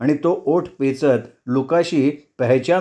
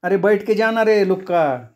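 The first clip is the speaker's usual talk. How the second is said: calling out; 6 semitones higher than usual. A narrator's reading. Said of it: "हसत माडीवरनं ओरडली".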